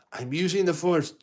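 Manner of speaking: put-on high, bright kid boast; proud little boy. Luke telling his dad about the Force, high proud kid voice. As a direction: announce it like a proud child. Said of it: "And he goes".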